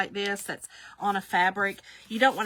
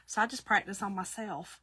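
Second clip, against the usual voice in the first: ordinary talk, nothing marked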